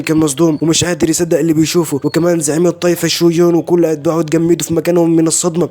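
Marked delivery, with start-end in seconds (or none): none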